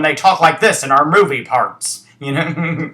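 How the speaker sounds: loud, put-on American movie accent; imitated foreigner's American accent. Host imitating foreign actors in movie parts, exaggerated mock American accent. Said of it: "when foreigners do, uh, um, American accent".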